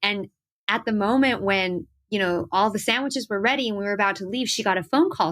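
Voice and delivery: ordinary talk, nothing marked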